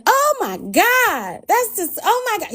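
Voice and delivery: hammed-up appalled squeal; mock shock. Expected shocked reaction, mocked.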